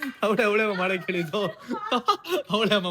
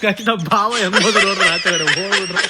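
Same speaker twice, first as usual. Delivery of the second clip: loud words bursting with laughter; real laughing fit while talking; 2 semitones lower, 5 dB louder than usual. Real, not acted. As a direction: crack up reporting the escape to viewers. Laughing at brother-in-law fleeing.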